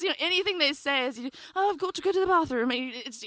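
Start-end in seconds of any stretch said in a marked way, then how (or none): 1.6-2.7 mock English accent, slightly raised pitch; put-on English voice. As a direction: imitate an English speaker announcing it grandly. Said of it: "'cause their accent's so amazing".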